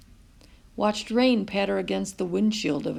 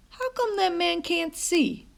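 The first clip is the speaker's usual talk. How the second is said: put-on high, innocent boy voice; curious boy voice; child's question; 10 semitones higher than usual. A narrator's reading. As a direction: ask bluntly like a small child. Child asks about blind man.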